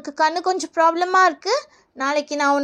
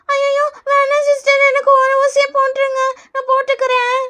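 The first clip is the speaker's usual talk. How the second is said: put-on high, whiny kid begging; pleading child; 5 semitones higher than usual. Pupil caught lying begs for one injection, pleading child voice.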